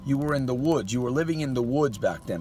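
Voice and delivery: ordinary talk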